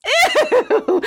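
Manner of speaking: high-pitched laughing squeal of disgust; grossed out. Real, not acted. Host reacting to a sweatier troll, grossed out. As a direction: recoil with a drawn-out, high ew.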